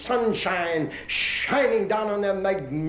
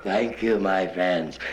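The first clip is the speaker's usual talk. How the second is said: governor thanks; 10 semitones lower, much slower than usual. Acted. Governor answers crowd.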